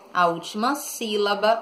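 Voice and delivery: normal talking voice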